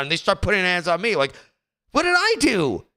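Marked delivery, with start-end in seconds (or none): 1.9-2.8 high, baffled "what did I do"; incredulous protest. Retold or imitated. Complaining about being called the aggressor, high and baffled. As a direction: throw it up high in disbelief.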